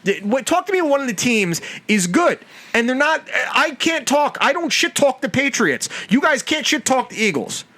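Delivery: high-pitched, exasperated argument; heated sports rant. Real, not acted. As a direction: argue it hard, pitch riding high. Heated rant at New York fans.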